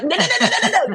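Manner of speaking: high, loud, hammed-up string of protests; mock panic. Trying to tip tears back, comically panicked.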